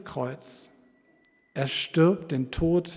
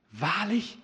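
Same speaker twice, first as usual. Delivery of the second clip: preaching at full force; 8 semitones higher, 12 dB louder than usual. Acted. Preacher proclaiming the centurion's confession, loud and high. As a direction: cry out the centurion's realization.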